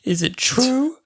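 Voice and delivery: high, hammed-up accusation; melodramatic accusing daughter. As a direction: confront your mother like a stage heroine. Acting out Ada confronting her mother.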